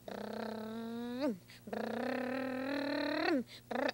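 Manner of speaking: low rumbling brrrm of a taxi motor; car engine noise. Pretend. Boy pretends to drive his imaginary friend in a taxi, engine rumble.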